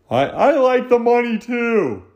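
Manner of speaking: high-pitched put-on voice; outsider butting in. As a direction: pipe up high, stretching the words.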